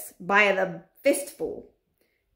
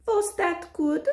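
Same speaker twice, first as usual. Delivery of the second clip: high, slow sly witch voice; slow, high coaxing witch; 10 semitones higher, slower than usual. Acted. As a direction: drawl it like a sweet-talking witch. Witch voice in read story.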